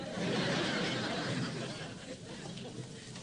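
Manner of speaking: very high, airy giggle; giddy high laugh. Acted. Jack tickled at his own answer, a very high, airy giggle. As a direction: giggle like a thrilled schoolboy.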